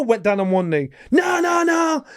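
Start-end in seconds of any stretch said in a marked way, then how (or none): 1.1-2.0 high, loud frantic no no; panicked refusal. Retold or imitated. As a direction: shout in alarm, backing out. Sparring partner refusing to continue.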